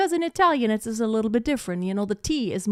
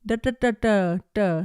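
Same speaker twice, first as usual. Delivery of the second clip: Indian tongue sounds demo. Shown as a demonstration. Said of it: "It's like".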